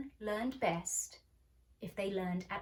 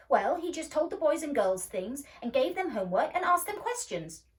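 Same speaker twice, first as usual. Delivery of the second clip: put-on louder, higher boy explaining; impatient explanation; 6 semitones higher, 9 dB louder than usual. A narrator's reading. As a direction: lecture her impatiently.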